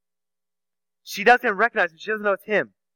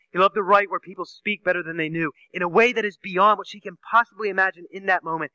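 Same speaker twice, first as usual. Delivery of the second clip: ordinary talk, nothing marked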